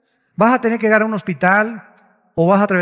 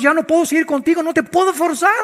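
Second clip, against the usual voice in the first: higher, lightly voiced weary God; God's voice; 7 semitones higher than usual. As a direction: speak as God giving up on the listener. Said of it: "dice Dios".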